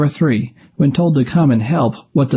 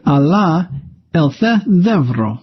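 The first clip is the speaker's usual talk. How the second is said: normal talking voice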